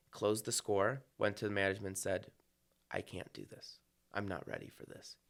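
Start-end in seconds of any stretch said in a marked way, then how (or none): 2.9-5.2 retold near-whisper, much softer; quietly admitting he's not ready; hushed quote. Conductor tells the management he isn't ready, in a hushed near-whisper.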